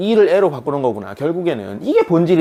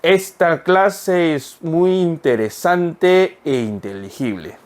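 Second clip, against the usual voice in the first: very slow, syllable by syllable; slow reading; much slower than usual. Shown as a demonstration. Teacher reading slowly to students. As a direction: read it slowly for learners. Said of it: "천천히".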